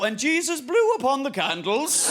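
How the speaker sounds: loud, lifted pulpit preaching; preacher voice. Pretend. Preacher at a service, hammed-up and loud. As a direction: proclaim it like a preacher. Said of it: "see a preacher go".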